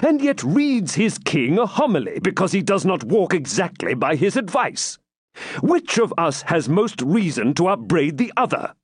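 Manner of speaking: high, emphatic royal rebuke; testy king turning the reproach round; impatient. A narrator's reading. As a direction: argue back impatiently. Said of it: "answered Richard impatiently".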